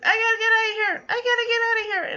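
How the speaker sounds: high, fast frantic chick voice; panicked chick. Retold or imitated. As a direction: squeak it out like a trapped baby chick desperate to flee. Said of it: "'cause it's like".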